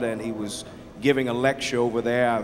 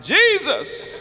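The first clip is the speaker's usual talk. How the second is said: put-on a boy's yell, much higher, breaking into laughter; hollered with a laugh; 5 dB louder than usual. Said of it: "He ran back and hollered".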